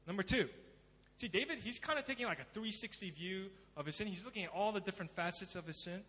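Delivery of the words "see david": normal talking voice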